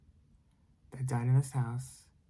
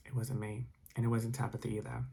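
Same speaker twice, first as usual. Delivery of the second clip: plain reading voice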